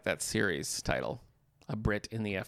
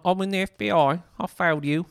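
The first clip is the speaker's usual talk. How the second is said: higher, put-on British actor impersonation; Michael Caine; 7 semitones higher, 6 dB louder than usual. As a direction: do a shaky attempt at the famous actor's accent. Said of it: "I can't do a Michael Caine".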